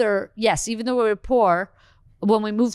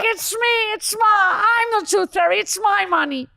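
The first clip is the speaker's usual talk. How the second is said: mother shouting; 12 semitones higher than usual. Retold or imitated. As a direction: yell it out like a frantic mom caught in the act. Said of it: "she's like".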